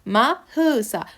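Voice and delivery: "مهسا" stretched into ma-heu-sa; syllable by syllable. Shown as a demonstration. Teacher writing her name in Korean, slow syllables. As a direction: slowly sound out "مهسا" with a vowel after h.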